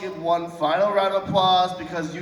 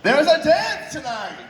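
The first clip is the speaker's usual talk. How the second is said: excited announcement; 6 semitones higher than usual. Real, not acted. Host announcing to crowd, laughing.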